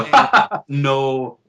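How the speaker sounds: high, loud fake laugh into refusal; hammed-up laughing rejection; mock dismissive laugh. Acting out the publisher's rejection.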